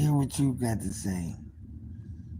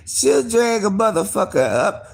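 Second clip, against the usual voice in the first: ordinary talk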